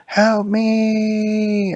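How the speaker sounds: high, loud, long-drawn damsel call; princess crying out. Acted. Princess calls to her rescuers, drawn-out mock cry. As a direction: wail it out like a far-off storybook princess.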